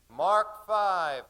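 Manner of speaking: put-on very high screamed child voices; children screaming. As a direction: shriek it like excited kids. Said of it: "all the children screamed".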